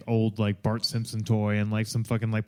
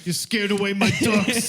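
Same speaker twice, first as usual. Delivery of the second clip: mock angry hunter; 7 semitones higher than usual.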